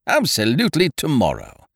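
high start, firm falling finish; emphatic confirmation. A narrator's reading. Ukridge confirming his promise to the hostess, emphatic. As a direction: confirm it with total assurance.

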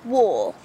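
"war" with the R dropped, like "waw"; low English "war" without R. Shown as a demonstration. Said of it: "don't pronounce the R right".

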